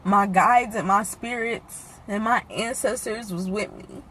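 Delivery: quiet, halting, fighting back tears; pausing long to keep from crying; holding back tears. Real, not acted. To viewers about her mother, holding back real tears. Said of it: "and I'm trying not to cry".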